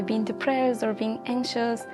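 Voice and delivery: usual speaking voice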